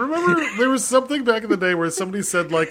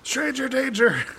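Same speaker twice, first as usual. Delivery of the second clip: mock kid scream; 7 semitones higher, 5 dB quieter than usual. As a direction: pretend to raise the stranger alarm, high. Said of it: "they would scream".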